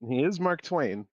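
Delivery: ordinary talk, nothing marked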